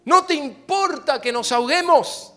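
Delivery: high, loud desperate cry; frightened disciples shouting at Jesus in the storm; shouting in fear. Acted. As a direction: yell it over the storm. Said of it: "Dice que le gritaron".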